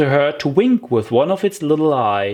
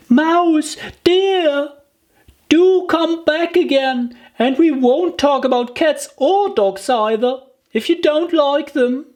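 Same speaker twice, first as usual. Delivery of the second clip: put-on much higher, gently calling girlish voice; soft calling. Alice calling the mouse back, lightly soft and coaxing. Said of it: "called softly after it".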